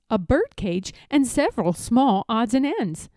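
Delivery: ordinary delivery, nothing marked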